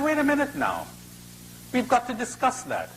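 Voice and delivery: high-pitched rabbit objection; interrupting rabbit. Acted. Rabbit halts the crocodile to discuss it, high and insistent. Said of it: "He said".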